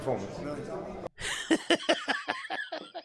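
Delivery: long squealing laugh; high laugh. Real, not acted. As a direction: let a high laugh ring out. Laughs at Eddie Hearn's face.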